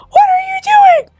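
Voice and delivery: high, loud screaming What are you doing; shocked shout at mom; panicked shout. Retold or imitated. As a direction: yell at her to stop.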